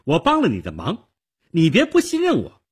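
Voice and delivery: loud, high-pitched reproach; stern accusation. A narrator's reading. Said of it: "少女厉声指责着". (厉声责备)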